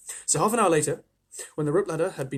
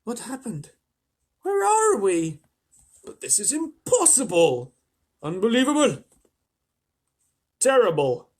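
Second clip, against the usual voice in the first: much higher, louder panicked exclamations; shocked cries; 8 semitones higher, 7 dB louder than usual. A narrator's reading. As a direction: gasp it in horror. Insects shocked at the sea.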